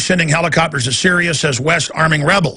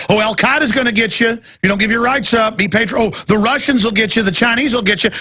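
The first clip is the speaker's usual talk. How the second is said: higher, sneering fearmonger voice; mocking globalists; 6 semitones higher than usual. Mocking the globalists' warnings, sneering higher voice. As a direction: mimic the globalists' scare talk. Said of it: "the globalists going".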